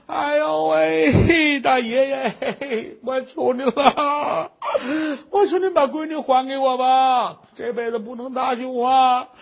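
drawn-out wailing plea, voice breaking; crying plea. Acted. Kneeling woman begs the rich master at the gate, wailing. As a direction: wail and beg through tears. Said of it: "跟这儿哭".

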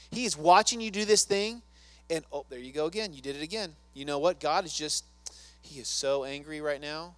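hammed-up accuser ranting; accusing rant. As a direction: nag and accuse, building. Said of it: "He does this to me every day".

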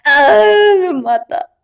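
loud, high frustrated cry; exasperated outburst. Acted. Vlogger venting about paying at the store, loud. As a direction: burst out in annoyance.

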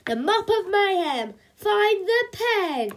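high, loud heroic proclamation; drawn-out rallying cry of a mock superhero; superhero battle cry. A narrator's reading. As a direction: declare it grandly, stretching the last words.

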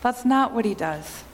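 ordinary talk, nothing marked

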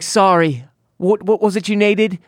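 a fake-sounding Australian voice assistant; co-host imitating phone's Australian Siri voice, mock Australian. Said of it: "the Australian".

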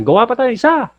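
high, loud yelled come-on; mock shouted proposition. Husband yelling at his wife to make another child, high mock shout. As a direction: yell let's make another as a joke. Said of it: "o kaya walang kamatayan".

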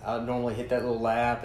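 ordinary talk, nothing marked

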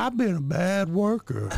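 high, slow coy elf voice; high coy elf role play; sexy elf. Acted. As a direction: coo it high as a naughty elf.

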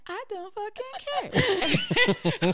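high, slow, laughing brush-off; laughing mock dismissal. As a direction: drag it out, laughing, uncaring. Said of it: "And I'm like".

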